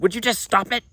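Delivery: high, loud exasperated snap; irritated snap. Retold or imitated. Snapping at Zeus in a bad mood, exasperated. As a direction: tell him to cut it out. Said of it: "it wasn't my best mood".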